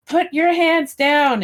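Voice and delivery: slow, high barked command; police shouting. Retold or imitated. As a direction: shout it like a cop. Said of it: "police shouting".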